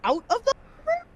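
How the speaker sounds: squeaky incredulous question; high, fast disbelief; shocked. Real, not acted.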